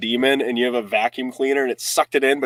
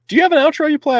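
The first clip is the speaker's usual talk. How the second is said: excited high question; 7 dB louder, faster than usual. Real, not acted.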